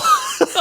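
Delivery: sudden squeal of laughter, very high; high burst of laughter. Real, not acted. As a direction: break into a high giggle. Laughing at his own prank.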